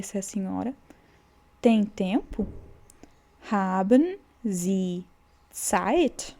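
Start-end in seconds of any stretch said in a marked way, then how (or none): 3.5-6.4 Haben Sie Zeit drawn out slowly, word by word; slow model. Shown as a demonstration. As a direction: spell it out slowly for the learners. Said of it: "o H, ele tem um som forte".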